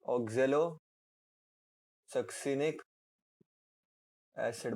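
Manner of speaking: oxalosuccinic drawn out very slowly, syllable by syllable; slow dictation. Shown as a demonstration. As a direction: stretch oxalosuccinic so the class can write it. Term repeated slowly for dictation. (oxalosuccinic बहुत धीरे, खींचकर)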